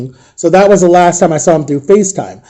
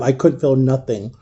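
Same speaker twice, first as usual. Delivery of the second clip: normal talking voice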